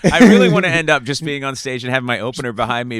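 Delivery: ordinary delivery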